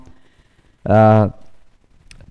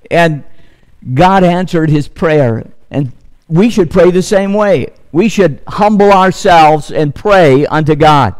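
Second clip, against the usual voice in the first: ordinary talk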